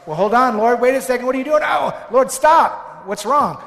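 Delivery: loud, high alarmed pleading; frantic shouting at the Lord; panicked protest. Acted. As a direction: cry out in alarm to stop him. Preacher acts frightened man in boat.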